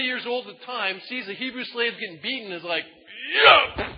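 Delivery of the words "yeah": sudden high shout of rage; explosive mock war cry; battle yell. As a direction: yell it as you strike the guy down. Moses attacks the slave driver.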